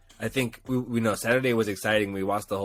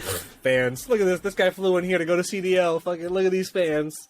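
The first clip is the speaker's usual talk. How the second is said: mock gloating; 7 semitones higher than usual. Mocking the man mocking him.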